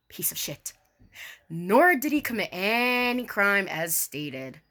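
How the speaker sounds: usual speaking voice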